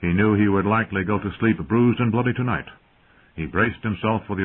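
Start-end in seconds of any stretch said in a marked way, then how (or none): none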